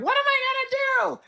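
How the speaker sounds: high, frantic whine of panic; panicking. Retold or imitated. Biting nails after the layoff, a high panicked cry. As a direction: squeal it like you just lost your job.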